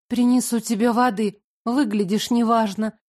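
plain reading voice

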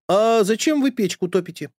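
put-on high, loud boyish question; high puzzled boy voice; curious boy Uncle Fyodor. A narrator's reading. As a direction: ask puzzled like a small boy. Boy asks nanny.

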